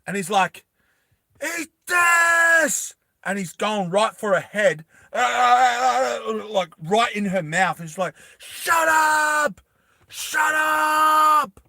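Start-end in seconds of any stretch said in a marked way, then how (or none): 1.4-2.9 high, loud, drawn-out action-hero yell; acted battle cry. Acts out minigun attack. 5.1-6.2 high, loud stuttering cry; imitated gunfire yell. Imitates firing at her head. 8.4-11.6 high, loud, drawn-out battle yell; acted furious shout.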